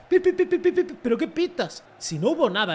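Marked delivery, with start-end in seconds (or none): 0.1-1.0 high, loud whistle-mimic "pi pi pi"; mock the ref's whistling. Mocking the referee's whistle. (imitando el silbato, agudo y fuerte)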